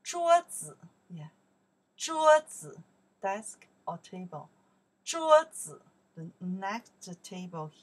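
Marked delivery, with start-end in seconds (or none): 0.0-5.8 桌子 pitched high and loud for the class; teacher modelling 桌子 loudly; model word. Shown as a demonstration. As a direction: call out 桌子 clearly to be repeated. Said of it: "we say".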